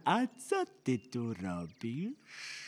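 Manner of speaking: slow, stretched-out coaxing question; sly wolfish questioning. Acted. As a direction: draw out every word slyly. Wolf slyly questions the girl.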